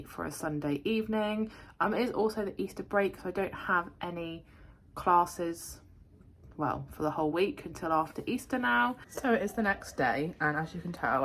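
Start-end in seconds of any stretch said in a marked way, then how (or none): none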